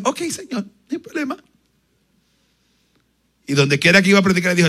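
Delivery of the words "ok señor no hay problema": high, light, willing reply; the preacher agreeing to the Lord; eager agreement. Retold or imitated. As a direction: answer brightly and willingly. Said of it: "Y yo dije".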